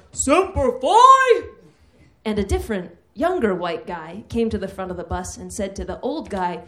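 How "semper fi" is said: much higher, louder, slow Marine bark of Semper Fi. Retold or imitated. Veteran greets old Marine on bus, loud slow bellow. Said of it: "I heard a loud".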